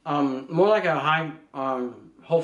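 chewing while talking; speaking around food; mouth full. Real, not acted. Seen 1: Man forks food into mouth at -2.0, then speaks mid-chew.

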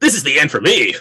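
high, loud mock death cry; put-on voice of a falling foe; doomed villain voice. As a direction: announce your own doom, hammed up. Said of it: "his voice was more nasally than that".

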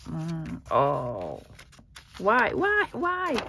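normal talking voice

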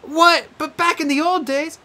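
high, startled outburst; can't believe it; shocked protest. Acted. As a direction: Annabeth protesting twenty riddles. Annabeth shocked.

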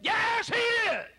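high, slow, shouted rebuttal; emphatic shout. Acted. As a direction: thunder the answer back slowly. Preacher answers the doubter.

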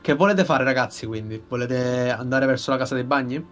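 ordinary delivery

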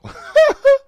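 high, loud burst of laughter; real high-pitched cackle of disbelief; amazed laugh. Real, not acted. Cracking up at the rap.

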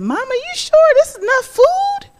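put-on high child's voice, fast; worried child. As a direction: ask Mama doubtfully. Child questioning mother.

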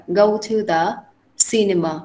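ordinary talk, nothing marked

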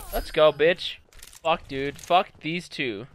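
ordinary talk, nothing marked

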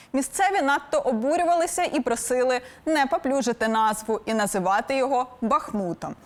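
normal talking voice

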